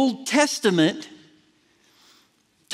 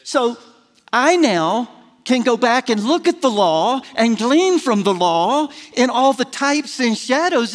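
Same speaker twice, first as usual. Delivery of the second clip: ordinary talk, nothing marked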